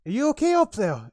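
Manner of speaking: loud, high shouted question; calling up. Acted. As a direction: holler up to someone at a distance. Guard shouts up to her colleague.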